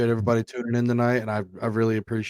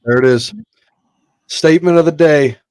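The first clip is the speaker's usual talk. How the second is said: excited agreement; 6 semitones higher, 12 dB louder than usual. Real, not acted. Agreeing loudly with a punchline.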